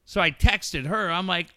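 ordinary talk

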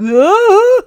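sudden high loud gasp of shock; stunned loud gasp. Retold or imitated. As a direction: gasp like your jaw just hit the floor. Guys gape, shocked speechless.

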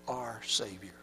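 low, soft whisper. Real, not acted. Preacher asking the congregation, soft whisper. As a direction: breathe the word out. Trailing, hushed question to congregation.

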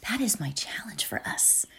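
a hushed invitation to the listeners; whispered challenge. Real, not acted. Setting the day's challenge for viewers, whispered. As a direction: whisper it, close and intimate.

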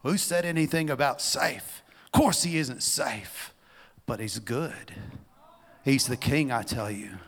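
emphatic, loud storybook retort; Mister Beaver. Acted. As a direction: push out the rebuke, then settle into assurance. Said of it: "said Mister Beaver".

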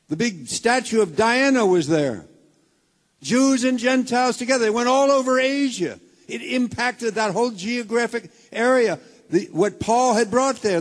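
loud, driving sermon delivery; full-force preaching. Acted. Pastor preaching hard to the congregation, full force. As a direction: preach it out to the back row.

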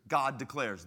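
usual speaking voice

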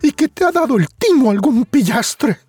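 high, scornful mother voice; mocking mother. Mother scoffs at Juan's bad trade. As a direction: sneer at the know-it-all son.

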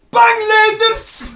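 high, loud, slow scared cry; mock frightened answer. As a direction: answer your name in panic. Scared self answers name. (hoog, luid en bang)

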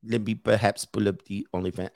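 put-on low, half-hearted Indian accent; attempted Indian accent. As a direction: try an Indian accent and not quite land it. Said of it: "I can't do my Indian accent today".

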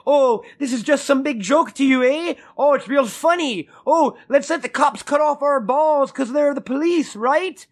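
high, sneering mock of an angry cameraman; hammed-up heckling interviewer, pitched high. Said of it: "He's like".

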